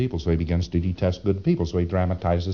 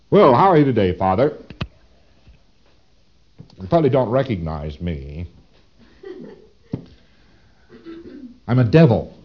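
mock devil greeting; 3 semitones higher than usual. Devil greets the holy Father.

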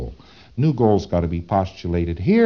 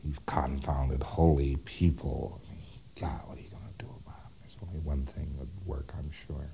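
a Thetan brooding in a soft whisper; whispered despair; 6 semitones lower, 14 dB quieter than usual. Retold or imitated. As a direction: whisper it bitterly to yourself. Thetan muttering his despair.

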